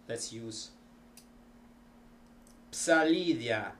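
usual speaking voice

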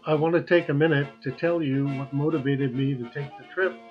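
ordinary talk, nothing marked

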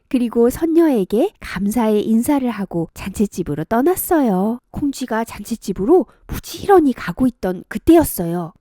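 ordinary delivery, nothing marked

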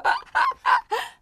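high, loud fake laugh; exaggerated laughter on purpose; mock laughing fit. Teacher mocking laughing at a car parade, fake laugh. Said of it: "하지는 않잖아요".

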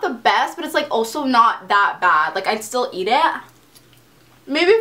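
eating between words; talking while chewing. Real, not acted. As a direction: mouth partly full of food. Seen 3: takes lasagna bites 6–10s, lips closed chewing while talking.

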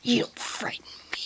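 menacing whisper. Acted. Kyuubi defiant.

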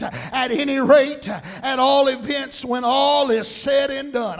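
loud, driving list of synonyms; preaching at full force. Acted. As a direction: punch out each phrase like a revival preacher.

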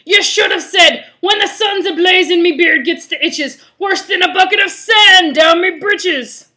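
loud, high, rough pirate voice; pirate holler. Acted. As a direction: holler the correction like a pirate captain. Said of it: "Pete hollered".

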